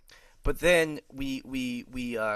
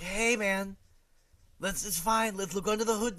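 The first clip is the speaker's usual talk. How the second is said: lightly voiced easygoing mechanic, pitched up; a hint of friendly mechanic; nice, wise mechanic; 6 semitones higher than usual. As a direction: reassure a panicking customer, lightly acted. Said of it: "nice, wise mechanic".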